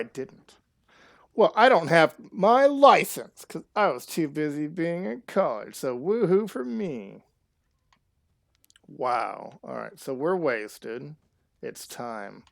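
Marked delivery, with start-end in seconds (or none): 1.3-7.2 sarcastic cheer. Real, not acted.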